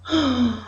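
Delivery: a heavy demo sigh; sad sigh. Shown as a demonstration. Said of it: "that's when you're sighing".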